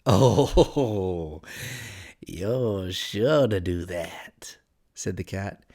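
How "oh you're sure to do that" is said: very slow, whispery cat voice; a drawn-out oh, then a whispery purr; sly Cheshire Cat. A narrator's reading. Cat assuring Alice, slow and whispery.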